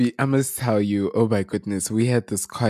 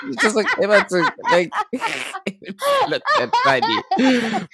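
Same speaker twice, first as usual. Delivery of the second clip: laughing; 13 semitones higher than usual. Real, not acted.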